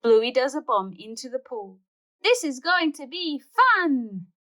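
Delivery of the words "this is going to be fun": high, louder, slow thrilled cry; excited child. Acted. Bluey jumping in the pool, high slow excited cry. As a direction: shout with glee before the splash.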